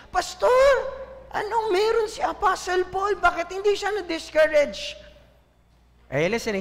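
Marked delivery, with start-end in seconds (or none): 0.1-5.0 high-pitched, loud plea to the pastor; raised-voice mock questioning of the pastor; questioning church member. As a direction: act out a puzzled member calling out.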